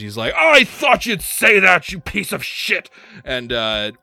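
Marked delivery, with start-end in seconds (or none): 0.4-2.9 high, loud and slow at first; gleeful battle taunt. Acted. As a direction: spit the taunt back at the monster. Wizard trading insults with monster.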